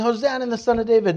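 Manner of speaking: quick, high-pitched cheer; mild shout. Acted. Children shouting in the temple, quick mild shout. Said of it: "they were shouting".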